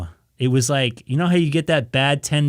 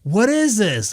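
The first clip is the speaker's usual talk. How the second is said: higher-pitched retold indignation; mock-angry demand, pitched up; pissed-off coworker; 8 semitones higher, slower than usual. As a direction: imitate a coworker getting pissed off. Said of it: "like, getting pissed".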